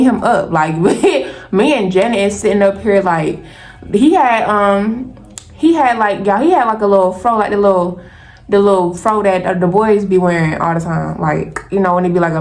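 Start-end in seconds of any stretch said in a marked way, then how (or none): none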